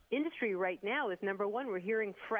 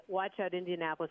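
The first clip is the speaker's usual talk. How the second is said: ordinary talk, nothing marked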